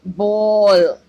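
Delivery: बॉल slow and loud, vowel held; slow stressed बॉल; long vowel. Shown as a demonstration. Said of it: "लंबा आ रहा है इसमें".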